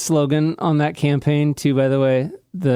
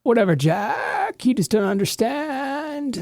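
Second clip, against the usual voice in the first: put-on higher, bored valley-girl whine; mock Kardashian; 11 semitones higher than usual. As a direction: lightly sneer like a spoiled celebrity. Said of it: "that's a Kardashian thing".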